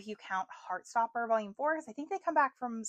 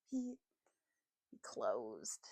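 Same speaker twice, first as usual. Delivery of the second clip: deep mock voice for the book; 10 semitones lower, 10 dB quieter, much slower than usual. Voicing the book's reply.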